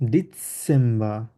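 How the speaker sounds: ডিসেম্বর drawn out, the c as ts; slow teacher model of ডিসেম্বর; slow German demo. Shown as a demonstration. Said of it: "এটা যেহেতু set". (ধীরে ডিসেম্বর, ts উচ্চারণ)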